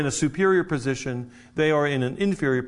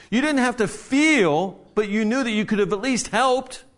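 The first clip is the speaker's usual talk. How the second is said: higher and louder, pressing the point; preaching with rising fervour; impassioned; 7 semitones higher, 3 dB louder than usual. Real, not acted. Preacher builds emphatic point.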